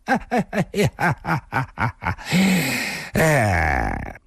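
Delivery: staccato cackle starting high and falling; cackling laugh. A narrator's reading. Drunk youth pointing at the woman, cackling. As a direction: cackle in a descending run. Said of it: "咯咯地笑道".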